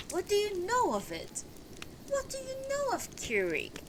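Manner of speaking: very high, restrained outcry; shocked cry. Acted. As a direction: demand it in high-pitched alarm. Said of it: "and I cried out".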